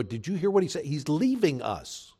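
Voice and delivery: a touch of shocked disbelief, partly slowed; mildly scolding a disciple who cheered; lightly voiced dismayed disciple; indignant disciples. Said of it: "The other ten would look at him and go".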